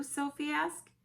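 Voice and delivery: usual speaking voice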